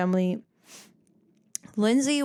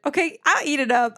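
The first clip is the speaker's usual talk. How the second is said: ordinary talk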